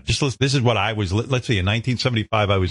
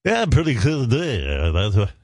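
mock smooth DJ. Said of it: "I'd sit there and listen".

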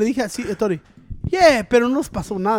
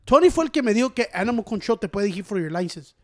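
ordinary talk, nothing marked

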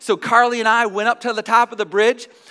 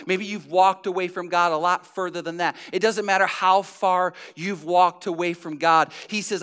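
usual speaking voice

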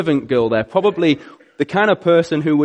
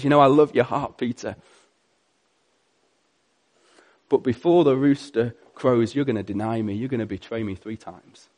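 gentle Jesus; 4 semitones lower, 4 dB quieter than usual. Acted. Jesus gently answers Peter.

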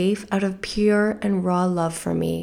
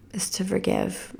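a soft whisper with no voice; a hushed whisper of forgiveness; 10 dB quieter than usual. Real, not acted. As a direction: breathe the words out as a whisper. Tender aside on forgiving.

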